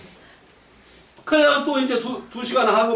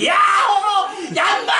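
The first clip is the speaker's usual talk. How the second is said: high shouted release; mock mountain yell; 11 semitones higher than usual. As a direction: yell it out to blow off steam. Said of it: "막 소리 지르고". (산에서 스트레스 푸는 높은 고함)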